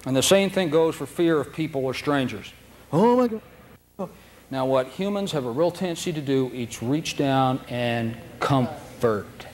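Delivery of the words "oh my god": high, slow, drawn-out gasp of dread; scared dog. Pretend. Frightened dog meeting strangers, high and slow. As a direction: stretch it out like a terrified pup. Fearful dog's inner voice.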